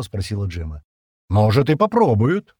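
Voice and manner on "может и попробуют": loud, raised, wry concession; wry agreement. A narrator's reading. Стэн agreeing they might try it, wryly. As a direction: concede it with a crooked smile. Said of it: "криво улыбнувшись, согласился Стэн".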